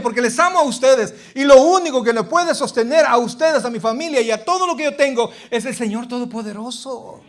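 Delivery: alza la voz, más aguda y fuerte, predicando con fervor; predicación con fuerza. Acted. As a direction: proclama su amor como desde el púlpito. (predicador a todo pulmón)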